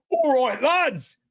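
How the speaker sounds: high, hammed-up London lad; London accent. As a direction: call it out in a stock London accent.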